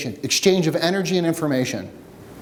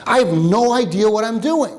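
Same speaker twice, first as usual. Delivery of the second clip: higher-pitched exasperated outburst; retold pissed off; 7 semitones higher than usual. Shooter raging in his own head, retold pissed-off outburst. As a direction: blurt it out in mock exasperation, pitch up. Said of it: "pissed off. I'm like".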